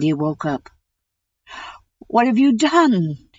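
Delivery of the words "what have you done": dismayed cry. A narrator's reading. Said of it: "he cried".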